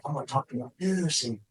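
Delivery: nervous speaker before a corporate crowd, timid quiet voice; nervous hushed inner voice. Retold or imitated. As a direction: murmur it like you're scared before going on stage. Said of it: "I'm like".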